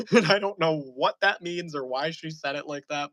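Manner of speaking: ordinary delivery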